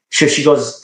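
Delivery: ordinary talk, nothing marked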